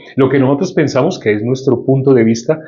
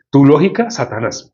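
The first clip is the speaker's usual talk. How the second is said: usual speaking voice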